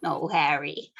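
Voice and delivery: soft, low lovers' talk; tender flirting. Acted. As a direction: murmur it close to her. Lovers flirting after the game.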